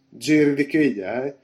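sarcastic parroting; mocking echo. A narrator's reading. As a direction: drag his words out as a sceptical question. Repeats the answer mockingly.

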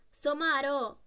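scornful insult. A narrator's reading. Grocer scorning the sleepy coachman, loud contempt. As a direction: spit the word out in scorn. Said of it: "borbottò il droghiere".

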